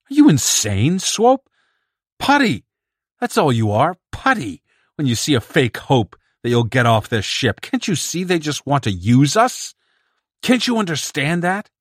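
high, loud furious yelling; furious outburst. Acted. Bedzik yells at Swope for believing the emissary, high furious shout. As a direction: shout him down in outrage.

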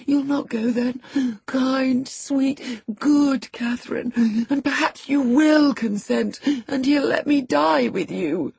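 high-pitched tearful begging, broken by breaths; sobbing plea. A narrator's reading. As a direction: coax her desperately through sobs. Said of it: "he sobbed".

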